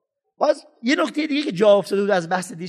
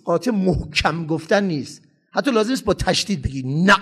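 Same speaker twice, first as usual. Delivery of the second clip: normal talking voice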